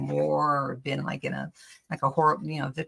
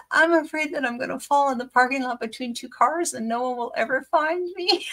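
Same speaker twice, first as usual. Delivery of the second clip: higher, half-crying retelling of a fear; retold tearful fear; 8 semitones higher, 4 dB louder than usual. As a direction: replay the teary confession to the doctor. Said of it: "I'm crying".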